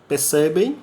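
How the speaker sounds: usual speaking voice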